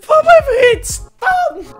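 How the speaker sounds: slow, high wail; very high pitch. Real, not acted. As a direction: stretch it out, half crying. Repeating old clip emotionally.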